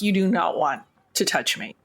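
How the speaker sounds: normal talking voice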